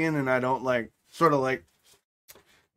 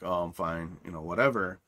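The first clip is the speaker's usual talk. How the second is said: much lower, softer, laid-back; relaxed bass tone; 8 semitones lower, 5 dB quieter than usual. Retold or imitated. Dad answering his daughter, much lower and relaxed. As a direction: answer low and relaxed, no energy. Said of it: "this sort of more deeper, like, more relaxed bass tone".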